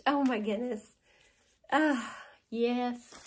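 normal talking voice

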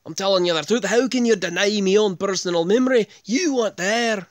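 loud, high mock Celtic brogue; put-on Irish or Scottish protest; Irish-Scottish accent. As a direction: argue indignantly in a hammed-up Scottish-Irish voice. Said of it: "was it a Scottish voice".

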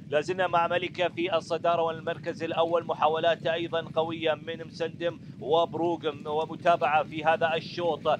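excited race call. Real, not acted. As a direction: build the excitement to the finish. Race climax, horses battling for lead.

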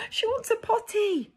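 high, loud alarmed cry. Acted. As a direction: call out the alarm. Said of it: "cried the maid".

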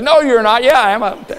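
high, loud, amused back-and-forth; mock bickering. People doubting she is from Texas, playful bickering.

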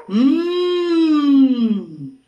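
"Mm" as one long, loud hum; pronunciación de la M. Shown as a demonstration. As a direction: hold "Mm" as a drawn-out m sound. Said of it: "se pronuncia así". ("Mm" alargado, como un zumbido)

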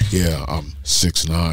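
laughing while voicing the giant man, deep and slow; giant dude voice. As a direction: play a huge man bragging lazily. Voicing the giant bar guy.

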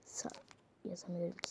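whispered complaint. Real, not acted. Whispering to the friend while typing. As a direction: whisper it out of the side of the mouth.

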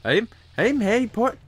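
high, halting British-Indian blend; British-Indian accent. Pretend. Host testing a halfway accent as Harry, British-Indian mix. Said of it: "halfway between British and Indian accent".

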